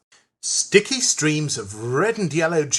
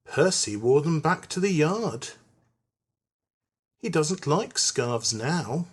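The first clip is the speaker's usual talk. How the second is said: plain reading voice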